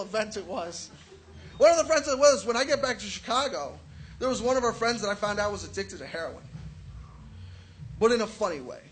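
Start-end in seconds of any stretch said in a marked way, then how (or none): none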